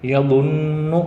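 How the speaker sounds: یظن with the نون held nasally one alif long; nasal ghunna. Shown as a demonstration. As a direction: hold the نون of یظن in the nose. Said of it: "ایک الف رکنا". (غنہ کے ساتھ یظن)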